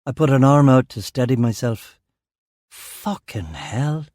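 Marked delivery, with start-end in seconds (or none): 2.7-4.1 groaned curse. A narrator's reading. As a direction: groan it out slowly, under your breath. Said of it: "I groaned".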